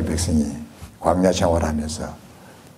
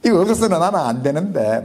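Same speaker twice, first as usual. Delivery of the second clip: much higher, fast, whining plea to God; complaining prayer; 12 semitones higher than usual. Retold or imitated. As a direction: pour out a grievance, half laughing at yourself. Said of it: "막 이런 생각으로 기도를 했죠".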